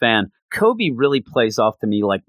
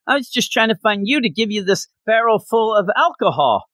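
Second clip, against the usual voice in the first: put-on much higher, flustered cabin boy voice; nervous Koby; 8 semitones higher than usual. Koby makes an excuse to the pirates, high flustered voice. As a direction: squeak out the excuse like a caught kid. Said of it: "He's like".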